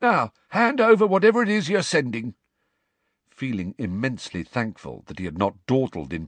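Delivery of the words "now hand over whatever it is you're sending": loud high furious caretaker; angry demand. A narrator's reading. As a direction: bark the order at him. Filch demands the letter.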